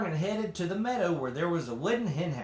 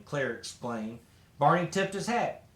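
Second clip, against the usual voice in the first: plain reading voice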